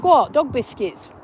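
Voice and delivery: ordinary delivery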